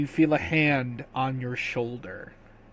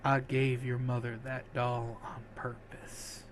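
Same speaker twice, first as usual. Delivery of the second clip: very soft, fading farewell; dying voice; 3 semitones lower, 13 dB quieter than usual. Acted. Dying man confesses to his companion, weak and very soft. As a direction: let the strength drain from every word. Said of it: "as the strength fades from him".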